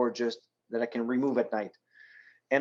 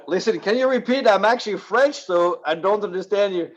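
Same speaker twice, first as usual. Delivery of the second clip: raised, louder, eager voice acting out his own radio call; retold radio plea; 7 semitones higher, 4 dB louder than usual. Kayaker radios a container ship captain, eager and raised. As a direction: replay the call with a lifted, pressing tone.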